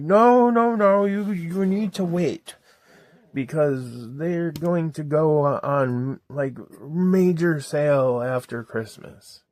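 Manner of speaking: high, slow insistent mother; mom voice. Retold or imitated. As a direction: imitate mom saying wait. Mom voiced in retold argument.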